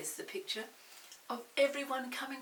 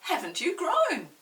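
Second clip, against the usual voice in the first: fond flock; 4 semitones higher, 10 dB louder than usual. Acted. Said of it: "who said".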